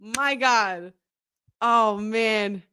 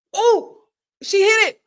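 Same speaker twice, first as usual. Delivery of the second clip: excited; 8 semitones higher, 6 dB louder than usual. Real, not acted. Friend lands the winning move.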